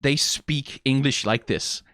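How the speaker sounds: normal talking voice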